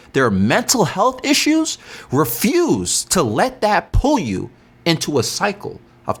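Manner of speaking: closing life lesson delivered like a sermon; motivational preaching. Acted. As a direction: preach it slowly, stretching each phrase.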